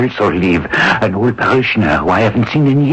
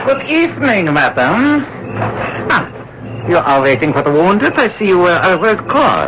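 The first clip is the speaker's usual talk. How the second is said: higher-pitched German officer, falsely polite; a courteous Nazi officer probing with menace; smooth, higher voice of an officer feigning friendliness; Gestapo officer; 11 semitones higher than usual. Acted.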